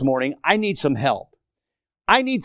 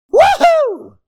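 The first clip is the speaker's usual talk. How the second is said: very high loud cheer; high whoop; 7 dB louder than usual. Acted. Preacher cheers like a fan, whooping.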